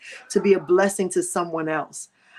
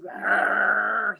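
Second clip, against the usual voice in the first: growling bird noise; 3 semitones higher than usual. Retold or imitated. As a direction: growl at the stranger. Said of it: "He was just".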